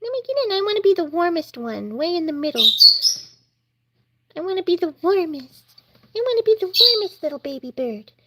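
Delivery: high little-chick voice, pleading; high baby bird voice. Pretend. Vlogger voicing a chick that wants the warm middle, high and pleading. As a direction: pipe it up like a tiny bird wanting in.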